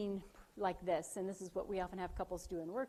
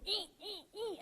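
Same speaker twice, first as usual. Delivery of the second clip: rising high-pitched squeals, much higher; mock winding up; 12 semitones higher than usual. To the audience, imitating herself amping up at husband: high mock squeals. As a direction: squeak up sharply, like a temper building. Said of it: "like, amp up like I do".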